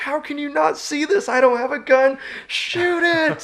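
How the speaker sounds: very high, loud desperate yell; frantic yelling. Retold or imitated. Unarmed hunter urging buddies to shoot, very high frantic yell. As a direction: scream at them to shoot. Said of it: "I'm like".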